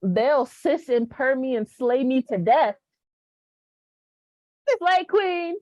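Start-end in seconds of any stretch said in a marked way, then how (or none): 4.8-5.6 high, sassy call. Pretend. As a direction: throw it out sassy. Playful sass.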